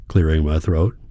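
ordinary delivery

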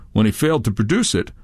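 usual speaking voice

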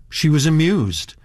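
usual speaking voice

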